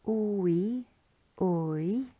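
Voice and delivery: "ooey" gliding as in gooey; combo vowel. Shown as a demonstration. Teacher running through combo vowels, "ooey" as in gooey. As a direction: sound out "ooey" twice with a rising end. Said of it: "as in gooey".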